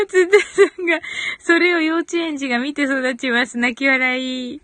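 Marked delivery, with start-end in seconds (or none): 0.1-1.0 laughing. Real, not acted. Laughing while reading a comment.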